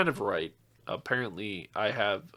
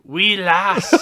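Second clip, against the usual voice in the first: put-on Scottish accent; 4 semitones higher, 6 dB louder than usual. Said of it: "Scottish Viking".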